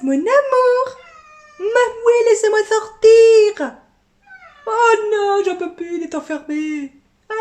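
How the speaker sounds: high whiny cat voice; pet voice. Pretend. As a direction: plead high as the trapped cat.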